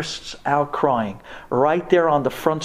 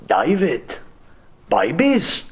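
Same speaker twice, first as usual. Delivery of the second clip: imitated German accent, easy self-assurance; breezy German-accented pronouncement; German doctor; slower than usual. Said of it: "I'll never forget his voice in my head".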